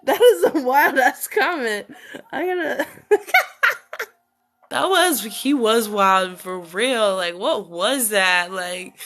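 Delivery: loud laughing shout; can barely talk for laughing; laughing hard. Real, not acted. Reacting to wild comment.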